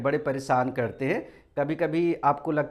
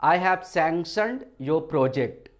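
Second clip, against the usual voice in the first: each word read out very slowly; slow dictation; much slower than usual. Shown as a demonstration. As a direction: spell it out slowly for students to copy. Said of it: "एक sentence मैंने आपके लिए बनाया है". (बहुत धीरे, शब्द-शब्द बोलकर)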